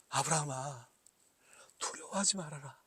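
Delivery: hushed, breathy comfort from God; God whispering comfort to fearful Abraham; gentle whisper. Acted. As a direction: whisper it like a father soothing a scared child. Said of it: "라고 말씀하시는 거예요".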